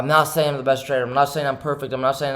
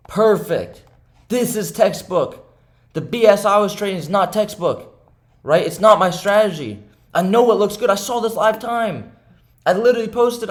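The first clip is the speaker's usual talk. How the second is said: higher and louder, hammering the point home; heated insistence; 6 semitones higher, 4 dB louder than usual. Real, not acted. Trader ranting to viewers about perfect setups, heated and raised. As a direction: pitch climbing high, pushing hard to convince.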